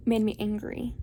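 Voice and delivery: normal talking voice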